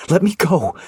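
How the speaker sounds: high, loud demand; angry shout. Acted. Wife crushed to husband's chest, angry shout. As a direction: yell it while squirming. Wriggling in his arms.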